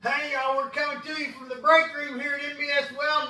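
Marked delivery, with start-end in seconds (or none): none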